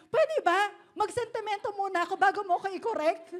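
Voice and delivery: mock-irritated retort; annoyed. Pastor imitates an annoyed friend snapping at the corrector, high and loud. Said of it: "nainis na siya".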